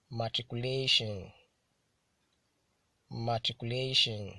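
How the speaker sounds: "Matriculation" with LA hit harder; careful model reading of "Matriculation", stressed on LA; penultimate stress. Shown as a demonstration. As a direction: punch LA in "Matriculation", then drop. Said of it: "stressed on the penultimate syllable".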